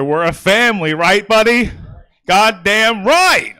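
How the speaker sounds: rally the crowd with it; hyped. Real, not acted. Comic hypes up audience member Buddy, loud and drawn out.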